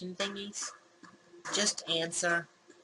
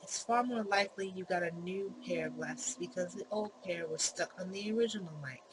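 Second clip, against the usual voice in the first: ordinary talk, nothing marked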